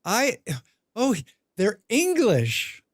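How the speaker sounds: slow starstruck "they're English"; a hint of an awestruck American. Americans meeting a Brit, mildly awed and slow. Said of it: "Americans who are like".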